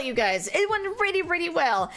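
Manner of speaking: usual speaking voice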